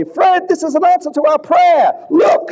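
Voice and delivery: put-on the elderly wife thrilled by the flyer; excited wife. Elderly wife excitedly telling her husband about the flyer.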